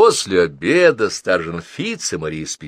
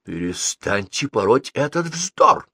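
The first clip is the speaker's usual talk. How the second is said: slow, higher, emphatic rebuke; exasperated raised protest; indignant cry; 6 semitones higher, slower than usual. A narrator's reading. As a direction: snap him out of his nonsense, leaning on each word. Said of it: "вскричала бабушка".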